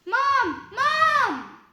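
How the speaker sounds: loud, high cry for Mom; calling out. A narrator's reading. Frightened girl calls her mum after a nightmare, shouting. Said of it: "Then she calls".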